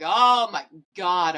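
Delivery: loud, drawn-out wail of dismay; retold exasperation. Patient told she must stay another night, loud exasperated re-enactment. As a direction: stretch it out, acting fed up. Retold despair at staying longer.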